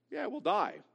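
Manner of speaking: casual, half-hearted admission; offhand concession. Acted. Eve downgrading God's warning of death, offhand. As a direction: shrug it off lightly. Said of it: "She's dropped that".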